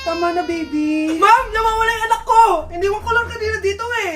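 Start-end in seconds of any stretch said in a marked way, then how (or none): 1.1-4.1 panicked cry. Acted. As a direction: cry out to the teacher in alarm. Mother panics over missing child.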